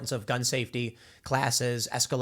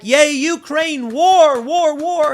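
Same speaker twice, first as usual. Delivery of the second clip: high, loud, slow sarcastic cheering; mock cheer; 13 semitones higher, 10 dB louder, much slower than usual. Mocking warmongering politicians, hammed-up high loud cheer. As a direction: ham up a bloodthirsty fan chanting for war.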